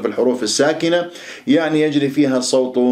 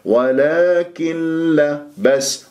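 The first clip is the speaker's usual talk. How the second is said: ordinary talk, nothing marked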